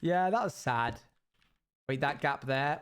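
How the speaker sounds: ordinary delivery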